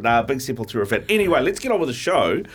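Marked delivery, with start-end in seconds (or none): none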